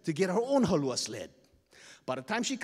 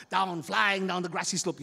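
ordinary delivery

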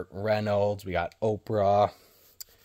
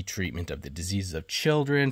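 ordinary delivery

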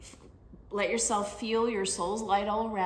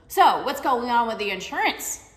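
snarky question; 2 semitones higher, 7 dB louder than usual. Retold or imitated. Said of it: "like a snarkiness".

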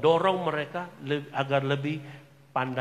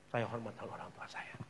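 whispered "saya hormati orang tua saya"; 4 semitones lower, 10 dB quieter than usual. Shown as a demonstration. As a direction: breathe the words, no voice. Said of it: "repeated statement".

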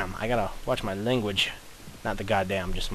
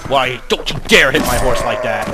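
much louder angry shout; 8 dB louder than usual. Real, not acted. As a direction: yell at the enemy. Enemy hit his horse.

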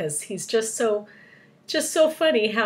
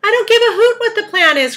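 high, loud indignant outburst; crying out; 12 dB louder than usual. A narrator's reading. Earthworm brushing off the plan, crying out. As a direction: protest at full voice. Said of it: "cried the earthworm".

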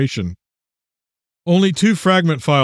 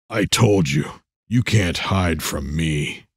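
low, slow, choked monster growl; gurgled menace; 9 semitones lower, 4 dB quieter, slower than usual. A narrator's reading. Demonic doll threatening a girl, gurgling low menace. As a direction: sound choked on decades of cigarettes, low and slow. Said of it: "he gurgled".

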